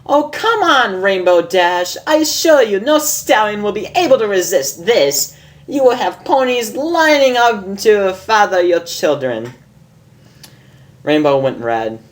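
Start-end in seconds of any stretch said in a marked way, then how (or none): 0.1-9.5 much higher, amused lady unicorn voice; a refined mare teasing her friend, higher; Rarity. Acted. Reads Rarity's lines in voice.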